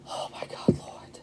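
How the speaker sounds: barely audible, stunned whisper; awed whisper. Real, not acted. Reader overwhelmed by the cards she turns up, whispering. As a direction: whisper it to yourself in disbelief.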